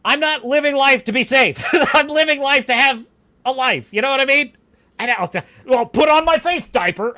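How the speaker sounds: loud, high, scoffing outburst; indignant rant. Real, not acted. Streamer scorning advice to be safe, loud indignation. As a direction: protest it loudly, half laughing. Ranting against being told be safe.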